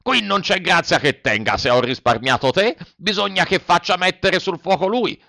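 lightly voiced gruff showman; a hint of a softened ogre; puppet master. A narrator's reading.

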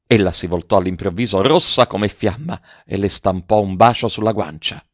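ordinary delivery

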